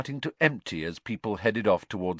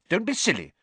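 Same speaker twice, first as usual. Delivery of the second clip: short; 11 semitones higher, faster than usual. A narrator's reading. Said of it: "said Hermione shortly".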